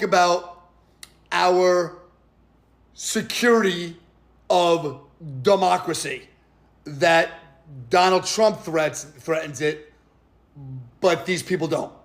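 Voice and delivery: low, halting mock-grave delivery; mock solemn. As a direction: drop low and space the words out with sarcastic gravity. Sarcastically mimics solemn democracy talk.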